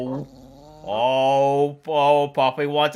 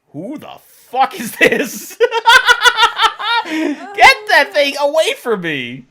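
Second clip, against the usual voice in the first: dog voiced through laughter; 9 semitones higher, 6 dB louder than usual. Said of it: "Dog is like".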